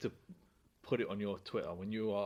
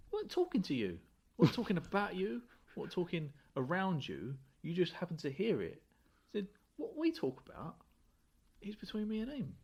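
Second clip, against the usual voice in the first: higher-pitched, mildly defensive quote; lightly acted protest, pitched up; retold indignant retort; 9 semitones higher than usual. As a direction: re-enact putting an eavesdropper straight. Retells own retort to complainer.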